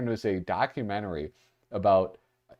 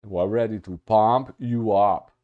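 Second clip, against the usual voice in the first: slow, put-on Hans and Franz accent; 3 dB louder than usual. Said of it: "Look at you, Hans and Franz".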